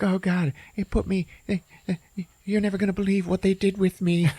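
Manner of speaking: high, complaining voice; a deflated little creature lamenting; hammed-up whiny victim; whiny cartoon condom. Condom telling woes.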